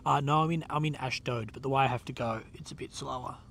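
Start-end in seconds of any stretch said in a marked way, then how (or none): none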